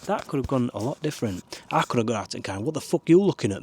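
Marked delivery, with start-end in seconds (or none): 2.6-3.6 mock aggressive. Imagined aggressive confrontation.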